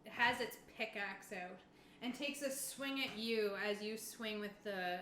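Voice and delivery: usual speaking voice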